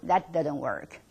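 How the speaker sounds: ordinary delivery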